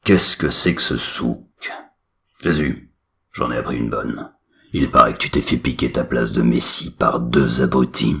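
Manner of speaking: a lightly voiced booming god; cavernous mystical voice. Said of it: "Une voix caverneuse et mystique".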